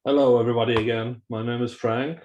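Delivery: normal talking voice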